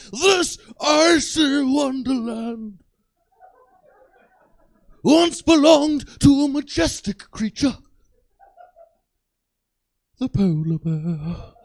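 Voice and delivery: slow, hushed-grave nature documentary narrator; David Attenborough impression. Comic imitating Attenborough narrating sadly to the audience. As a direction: mourn the polar bear like a wildlife film. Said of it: "he stands out there to yell at us".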